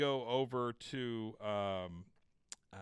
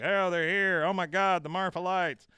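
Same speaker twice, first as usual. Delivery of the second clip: excited Dale; 7 semitones higher, 8 dB louder than usual. Retold or imitated. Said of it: "Dale's like".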